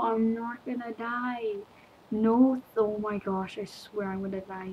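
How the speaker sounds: usual speaking voice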